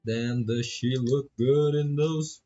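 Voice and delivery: ordinary delivery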